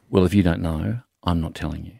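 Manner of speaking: low-pitched impression of a huffy partner; Lisa. Man imitating his partner for the hosts, low and huffy. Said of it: "I'll do my impression of Lisa".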